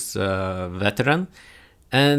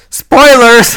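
loud, high playful yell; shouting; 13 semitones higher, 14 dB louder than usual. Acted. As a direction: shout spoilers gleefully. Shouting a mock spoiler warning.

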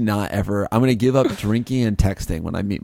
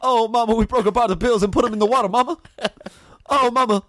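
much higher mock Southern soldier wailing for mama; hammed-up high lament, climbing steadily higher; Southerner dying at war; 10 semitones higher, faster than usual. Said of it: "a Southerner dying at war".

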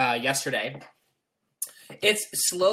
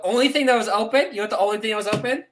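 building excitement; 6 semitones higher, 5 dB louder, faster than usual. Real, not acted. Building up the punchline.

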